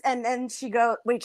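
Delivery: ordinary delivery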